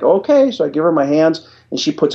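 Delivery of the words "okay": high, bewildered okay; startled okay. Retold or imitated. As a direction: agree without understanding. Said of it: "And I'm like".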